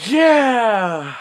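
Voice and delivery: high, loud victory whoop; excited cheer. Real, not acted. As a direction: cheer as it blows up. Car explodes.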